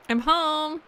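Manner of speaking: Skelly arriving home. Acted. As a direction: call out gently as the returning Skelly. Skelly's lines read aloud.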